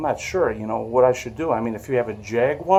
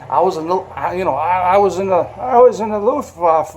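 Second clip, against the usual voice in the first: put-on higher, halting old man with an accent; old veteran's accented voice; 5 semitones higher, 3 dB louder than usual. Retelling a veteran admitting his war service, imitated old accented voice. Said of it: "his old voice, his accent".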